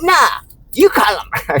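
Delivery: high, slow mocking shout of the man; shouting back at me, hammed-up. Man refusing to call, shouting in a high mock voice.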